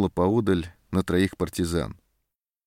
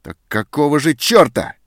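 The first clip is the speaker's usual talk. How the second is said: loud, high enraged shout; furious outburst; 7 semitones higher, 9 dB louder than usual. A narrator's reading. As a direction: explode at her in rage. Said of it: "взъярился сержант".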